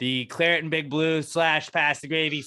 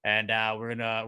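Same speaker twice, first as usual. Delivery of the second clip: ordinary talk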